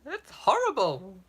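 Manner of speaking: high, slow, prissy refusal; hammed-up genteel horror; posh mock disgust. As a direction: sniff at it like a fine lady.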